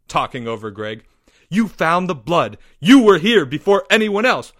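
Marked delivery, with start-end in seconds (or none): 1.5-4.5 louder, higher, building accusation; heated accusation. Acted. Leo interrogating Porge at the scene, heated accusation. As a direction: press the suspect hard.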